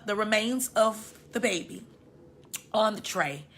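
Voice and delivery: ordinary talk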